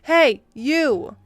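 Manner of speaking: mock angry callout; calling out. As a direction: hail her sharply. EM calls across produce aisle.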